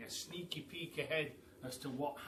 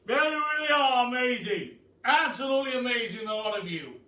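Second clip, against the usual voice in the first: higher, loud, booming praise; old Duke praising the animals, loud and higher; 9 semitones higher, 13 dB louder than usual. Acted. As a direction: hail them like a grand old duke. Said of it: "changing acting mode".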